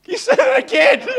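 high, loud line broken by laughter; laughing exclamation. Retold or imitated. Pastor laughing at the daughter's answer, high and loud. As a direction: crack up at the memory. Comic punchline of funeral story.

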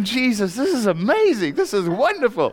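high, loud joyful exclamation with a laugh; laughing praise, voice lifted high; overjoyed. Retold or imitated. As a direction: burst out in delighted wonder. Overjoyed at the stranger's tears.

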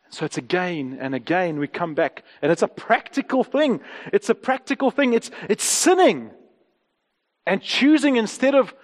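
usual speaking voice

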